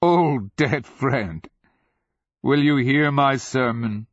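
high, projected oratory; calling out. A narrator's reading. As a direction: call across to an old foe, stretching the words. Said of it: "the Nonman called".

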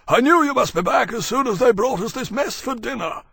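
very high, loud creature voice; dragon Maximus, hearty. Acted. Maximus teasing Temeraire about dinner, loud and hearty. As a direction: boom it out to an old friend.

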